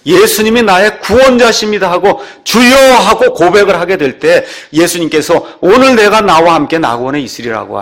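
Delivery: loud, high-pitched sermon delivery; full-force preaching. Real, not acted. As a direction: proclaim it forcefully to the congregation.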